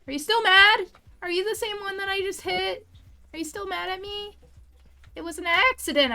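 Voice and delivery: high-pitched, worried questioning; anxious pleading. Real, not acted. Player asking the creature just hit if it's mad, anxious high pleading.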